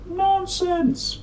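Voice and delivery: very high, prim cat voice; Flabby Cat voice. Acted. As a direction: dismiss it in a lofty, high cat voice. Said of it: "said Flabby Cat".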